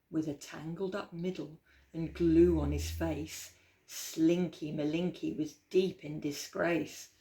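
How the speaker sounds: put-on lively, rhythmic read-aloud for kids; storybook reading. A narrator's reading. Storyteller reading Slinky Malinky aloud to children, lilting and playful. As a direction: read the rhyme with bounce and relish.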